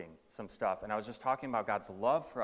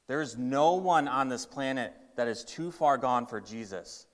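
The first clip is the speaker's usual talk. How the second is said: ordinary talk, nothing marked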